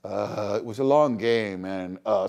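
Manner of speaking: low, soft, weary ballplayer mumble; halting, low post-game voice; ball player interview. Retold or imitated. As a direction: drone like an athlete worn out after a doubleheader. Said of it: "sounds like a ball player after a doubleheader".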